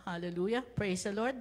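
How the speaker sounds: ordinary delivery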